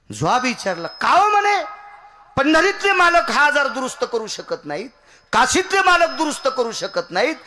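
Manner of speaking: high, loud kirtan cry to the gods; preaching at full force. Acted. Preacher crying out to the gods in kirtan. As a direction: belt it out like a temple preacher.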